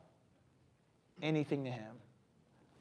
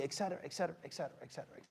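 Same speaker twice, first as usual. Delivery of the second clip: dropping to a near-inaudible tail; fading away; 3 semitones lower, 15 dB quieter, much faster than usual. Real, not acted. Preacher cutting short a list of greedy motives, fading out. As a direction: let the repetitions sink away softer and softer.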